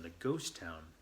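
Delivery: usual speaking voice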